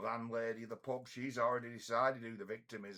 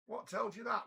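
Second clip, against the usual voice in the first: incredulous question; 11 semitones higher than usual. Retold or imitated. Retold dialogue with injured man.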